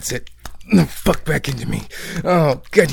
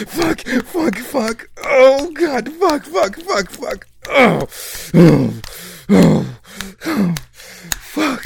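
much higher, loud, breaking moans and curses; climax cries; 10 semitones higher, 6 dB louder, much slower than usual. Acted. Lover climaxing with partner, high loud cries. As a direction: let go completely at the peak.

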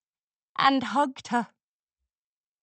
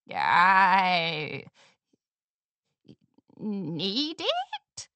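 put-on hesitant old mare; 3 semitones lower, much slower than usual. A narrator's reading.

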